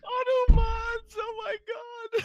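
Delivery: cracking up, high and loud; laughing through the words. Real, not acted. Laughing at the misquote.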